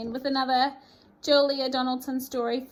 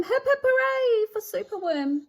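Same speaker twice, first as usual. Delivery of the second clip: high, loud hooray cheer; bright triumphant chant; 9 semitones higher, 6 dB louder than usual. Acted. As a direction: cheer him on like a crowd. Creatures cheer their hero.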